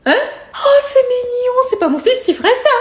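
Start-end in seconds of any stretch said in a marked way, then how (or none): 0.6-1.6 touched. Acted. Mother touched by the tattoo detail.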